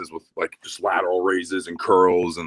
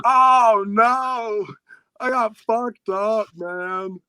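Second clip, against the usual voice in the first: mock horrified wail; 12 semitones higher, much slower than usual. Teen seeing his blood in restroom, high mock wail. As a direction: wail in comic dismay, then tail off.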